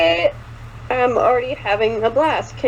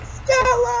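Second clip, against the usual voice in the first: very high, drawn-out call; hammed-up long holler of a name; imagined shout; 12 semitones higher than usual. Shown as a demonstration. Showing a friend how they pictured it, hammed-up shout. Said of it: "when you said shout Magnolia, I imagined".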